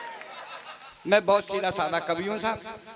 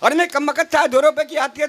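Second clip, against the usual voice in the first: ordinary talk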